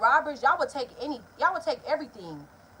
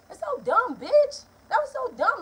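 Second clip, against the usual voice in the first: much higher and louder, fed-up cry; exasperated outburst; 11 semitones higher, 5 dB louder, slower than usual. Real, not acted. Ranting to viewers about the truck owner, high exasperated shout. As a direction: throw your hands up and shout it.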